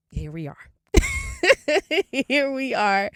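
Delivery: high burst of laughing into words; real laughter. Real, not acted. Podcaster back after the DMV advice, laughing. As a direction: laugh helplessly, then say it.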